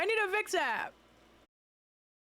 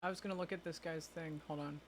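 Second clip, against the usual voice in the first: quiet; 9 semitones lower, 12 dB quieter than usual. Real, not acted. Speaking very softly.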